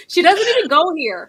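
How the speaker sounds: put-on loud, laughing quote of the Mean Girls line. Cohost echoing a Mean Girls line, loud and laughing. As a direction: shout it through a laugh like the movie. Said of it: "it's very, very Mean Girls".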